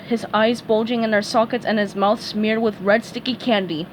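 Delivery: usual speaking voice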